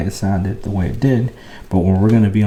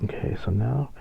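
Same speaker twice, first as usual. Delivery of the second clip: much softer, close to the mic; speaking quietly; 11 dB quieter than usual. Shown as a demonstration. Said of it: "I'm speaking quietly in the microphone".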